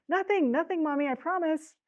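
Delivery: put-on high child's voice, earnestly denying; pleading little girl. A narrator's reading. Jill promising her mother nothing is broken, high anxious denial. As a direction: plead high like a small daughter.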